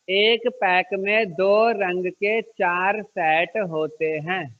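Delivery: slow, word by word for copying; slow dictation. Shown as a demonstration. Teacher dictates to pupils for copying, slowly. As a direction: spell it out slowly so they can write. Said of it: "चलो copy करो उसको पहले".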